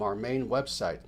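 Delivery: normal talking voice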